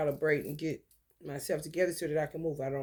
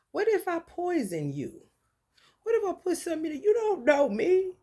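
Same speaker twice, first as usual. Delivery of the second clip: much higher, rising to a loud What if I poison you; irritated high retort; 11 semitones higher, 3 dB louder than usual. Retold or imitated. Retelling how she snapped at a man on the street, irritated and high. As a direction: fling it at him, pitch way up, exasperated. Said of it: "I just was so irritated".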